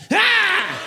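sudden high loud comic yelp; mock outraged shriek. Comic shrieking at the clerk's rudeness. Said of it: "she's one-upping me".

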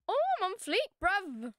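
high, loud brag; excited boast. Real, not acted. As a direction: crow it out loudly. Boasts in the game.

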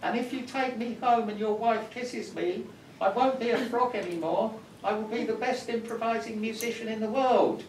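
high, loud squeaky frog bragging; a tiny frog puffing itself up; boastful frog. Acted. Frog boasting to the man who picked it up, high squeak. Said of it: "and the frog said".

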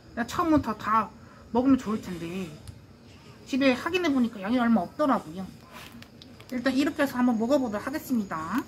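muffled behind cloth mask; speaking with covered mouth; through a face mask. Real, not acted.

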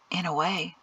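said softly. A narrator's reading. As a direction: answer gently under your breath. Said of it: "the man said softly".

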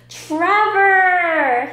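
high, loud yell for a friend; storybook yell. Acted. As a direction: shout across the distance. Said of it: "he yelled".

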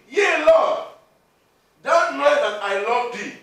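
Peter calls out his answer loud; loud reply. Acted. Peter answering Jesus, a loud earnest cry. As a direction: proclaim it to the Lord with full force.

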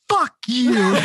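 mock-triumphant telling off the manager; triumphant kiss-off. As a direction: land the final insult.

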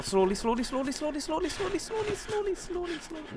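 nervous falsetto coaxing; rising squeaky plea. Real, not acted. Gamer coaxing his character down a slope, rising falsetto.

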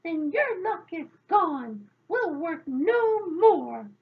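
loud, slow, high-pitched indignation; angry grumbling. A narrator's reading. Thwarted fairies giving up at the door, loud cross grumble. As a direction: declare it crossly, stretching the last words. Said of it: "began to grumble and growl".

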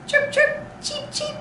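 very high peeping chick voice; chick chirps. Pretend. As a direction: chirp like a tiny baby chick. Imitating chick sounds for kids.

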